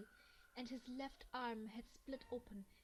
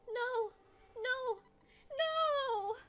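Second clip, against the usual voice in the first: shrill, far higher and much louder cries of no; insane screaming; 12 semitones higher, 15 dB louder, much slower than usual. Acted. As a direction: shriek it, losing your mind. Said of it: "It was an eerie scream".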